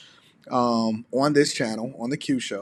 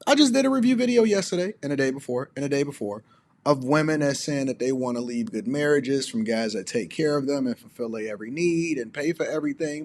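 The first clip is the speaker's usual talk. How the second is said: ordinary delivery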